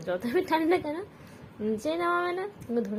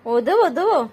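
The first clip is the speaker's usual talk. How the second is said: 1 loud playful tease; 5 semitones higher, 10 dB louder than usual. Real, not acted. Teasing the pet.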